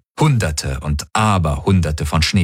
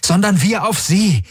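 urgent, very high-pitched exclamation; calling out; 13 semitones higher than usual. A narrator's reading. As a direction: cry it out in sudden realisation. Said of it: "rief Galen".

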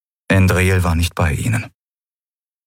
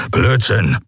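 grumble. Acted. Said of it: "brummte Keru".

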